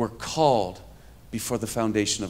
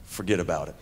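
low, quiet New York brush-off; understated Bronx "forget about it"; Bronx dismissal; 6 semitones lower, 5 dB quieter, faster than usual. Retold or imitated. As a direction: drop it softly in a Bronx accent. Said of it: "And I said".

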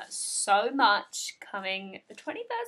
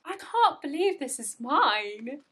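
ordinary talk

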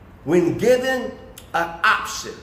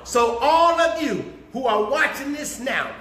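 ordinary talk

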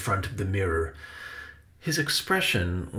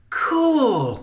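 very high, loud, drawn-out cheer; high-pitched awestruck shout; excited cry; 7 dB louder than usual. A narrator's reading. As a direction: cry out like a thrilled kid. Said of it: "cried Benny".

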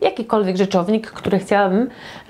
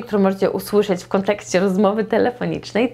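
ordinary talk

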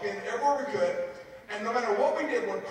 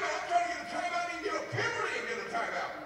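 usual speaking voice

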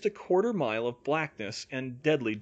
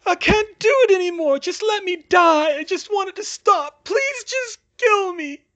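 high, loud, wailing plea; desperate, near sobbing; 9 dB louder than usual. A narrator's reading. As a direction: shout and beg, slowing toward sobs. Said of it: "dissolved into incoherent sobbing".

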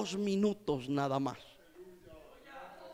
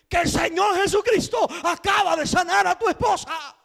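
repeated joyful announcement; 7 semitones higher, 5 dB louder than usual. Retold or imitated. Repeating it to the confused husband.

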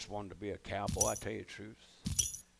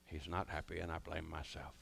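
mock remorse; 8 semitones lower, 10 dB quieter than usual. Mock remorse repeated more softly.

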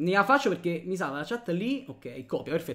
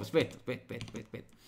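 hushed hurry; 7 semitones lower, 10 dB quieter, faster than usual. Real, not acted. Hushed, flustered.

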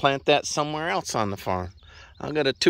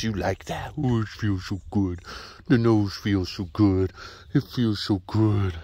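voicing the horse; 6 semitones lower than usual. Voicing the horse being scratched.

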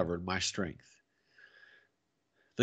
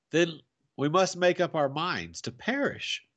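higher, mildly despairing; helpless cry; 6 semitones higher than usual. A narrator's reading. Nephew resigned to perishing, helpless cry. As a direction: plead helplessly, pitched up. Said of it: "I cried with a helpless sigh".

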